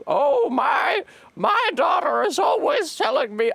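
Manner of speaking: put-on old woman at the clinic, high and loud. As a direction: squawk like a chatty old woman.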